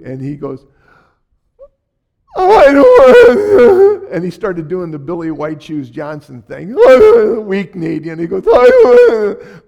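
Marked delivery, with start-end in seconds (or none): 2.3-4.1 high, drawn-out crying whine; imitated child wailing. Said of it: "He was crying so loud". 6.8-7.4 high loud crying wail; imitated wail. Child cries at spanking threat. 8.5-9.6 high wailing cry; imitated child wailing. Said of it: "like this".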